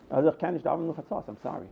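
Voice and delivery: fading into a low, soft apology; retold apologetic refusal. Attendant excusing himself from the Rebbe's request, softly apologetic. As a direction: back out quietly, voice sinking away.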